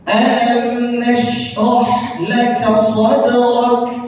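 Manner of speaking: slow chanted verse; slow, measured Quranic chant; recitation demo. Shown as a demonstration. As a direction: recite slowly for students to repeat. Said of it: "Silakan diulangi".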